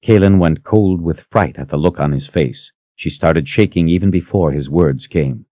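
ordinary delivery

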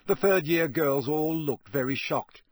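ordinary delivery

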